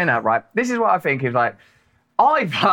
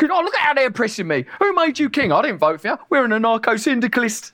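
higher pitched mock peasant from Holy Grail, indignant; a higher, indignant Pythonesque peasant complaining; Michael Palin; 7 semitones higher than usual. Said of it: "with Michael Palin".

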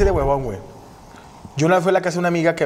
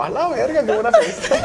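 high-pitched squeal of laughter through the words; laughing punchline; 11 semitones higher than usual. Retold or imitated. Storyteller mock-marvels at the dog's wild party, laughing. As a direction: crack up on your own punchline.

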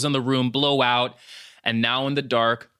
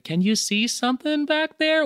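high, timid questioning voice; nervous whisper-like question; light scared girl voice. Retold or imitated. Voicing Miranda in story.

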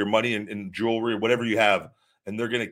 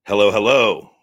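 louder hello, calling out; 11 dB louder than usual. Real, not acted. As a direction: call out to get attention. Calling out to someone.

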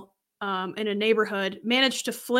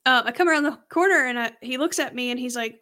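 ordinary talk, nothing marked

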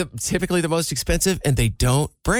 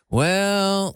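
"Well" stretched long and higher; drawn-out "Well"; 4 semitones higher than usual. Shown as a demonstration. Showing own catchphrase when asked about his day, drawn-out "Well". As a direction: draw out "Well" as a verbal crutch. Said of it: "You, like, draw it out".

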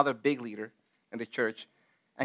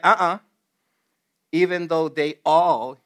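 loud, mildly acted refusal, then slowing; taking it personally; 7 dB louder than usual. Preacher as Peter answering Jesus, emphatic objection. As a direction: push back as if personally accused. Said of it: "Peter took it personal".

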